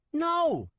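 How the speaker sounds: put-on high loud boy's yell; child's yell. Nico yelling back at Swen, high loud child. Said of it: "I yelled back".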